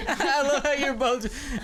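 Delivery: laughing through the words; slow, laughing delivery. Real, not acted. Laughing while speaking.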